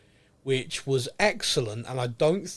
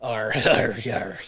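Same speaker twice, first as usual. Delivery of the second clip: laughing pirate arr; 3 semitones lower than usual. Pretend. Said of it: "speak like a pirate".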